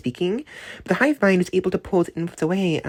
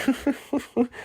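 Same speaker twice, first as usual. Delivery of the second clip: scoffing laugh; 8 semitones higher, 4 dB quieter than usual. Retold or imitated. Brother scoffs then laughs.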